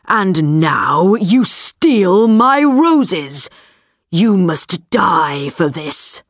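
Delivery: slow, distorted beast roar; deep distorted monster roar. Acted. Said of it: "roared the beast".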